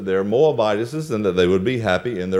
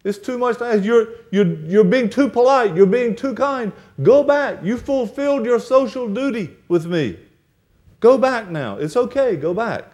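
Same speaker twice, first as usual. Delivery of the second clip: higher, insistent mother-in-law urging; pleading Naomi; 8 semitones higher than usual. Acted. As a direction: push them away with earnest pleading. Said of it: "she said".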